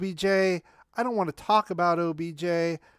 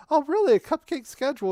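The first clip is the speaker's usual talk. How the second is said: incredulous; 8 semitones higher, 5 dB louder than usual. Real, not acted. Mocking a fan's cupcake claim.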